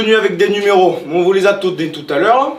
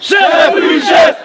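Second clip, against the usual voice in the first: barked military reply, all together; loud gung-ho soldier; 9 semitones higher, 5 dB louder, much slower than usual. Real, not acted. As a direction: shout it back like eager recruits. Recruits answer drill sergeant.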